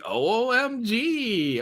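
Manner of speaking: slow drawn-out valley speak OOMG; valley girl voice. Shown as a demonstration. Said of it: "talk like that".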